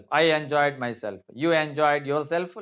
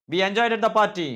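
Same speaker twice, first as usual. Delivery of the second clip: loud wrong example; 6 semitones higher, 5 dB louder than usual. Shown as a demonstration.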